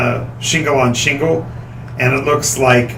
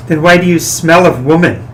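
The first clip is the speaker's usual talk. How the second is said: suspicious noblewoman; 3 semitones higher than usual. Acted. NPC lady suspicious.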